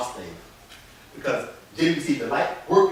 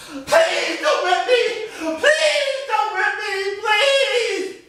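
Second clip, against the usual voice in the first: much higher, very slow pleading wail; a terrified child begging; begging girl; 6 dB louder, much slower than usual. Acted. Girl begs.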